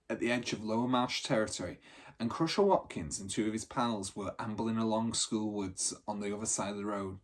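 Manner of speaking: usual speaking voice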